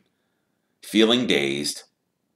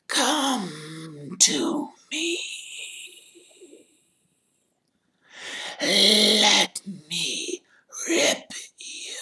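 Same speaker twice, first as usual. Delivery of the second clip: very high, slow, whispered ghostly hiss; icy venomous voice; much slower than usual. Acted. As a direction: breathe it like a hungry phantom. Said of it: "A voice so chill to the bone marrow. A voice of breathtaking, ice-cold venom".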